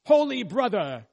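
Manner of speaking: calling out to a member. Retold or imitated. Preacher calling out a congregant's name, high and loud. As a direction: call his name out loud to grab attention. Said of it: "pointed to one of you and said".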